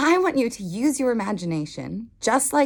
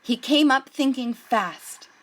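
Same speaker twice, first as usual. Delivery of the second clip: put-on gentle read-aloud voice for children; unhurried picture-book narration; storybook reading. A narrator's reading.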